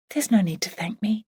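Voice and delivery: hushed, modest whisper; soft whisper. Acted. Emily brushing off her grieving friend's thanks, whispered. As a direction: breathe it, almost no voice.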